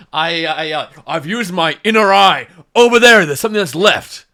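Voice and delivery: loud, high, excited shout that something left; yelled warning. Acted. Monk yelling to the party that something fled over there, loud and high. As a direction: call out loud to the party, alarmed. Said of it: "Not in that voice".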